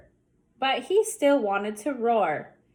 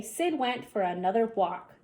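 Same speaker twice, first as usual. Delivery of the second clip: ordinary delivery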